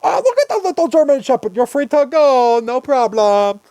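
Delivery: very high, loud cooing officer; gushing cop voice. Pretend. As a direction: coo over the puppy, loud and high. Voicing cop won over by dog.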